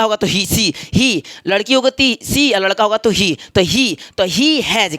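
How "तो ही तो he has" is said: slow, stretched model; teacher slowly stressing the pronoun; slow emphasis. Shown as a demonstration. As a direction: draw out he has for the class. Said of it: "ही छोटका चाचा है तो हैज".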